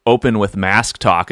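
ordinary talk

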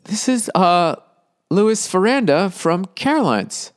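lightly mock the booker's slow phone message; imitating the club owner. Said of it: "He's like".

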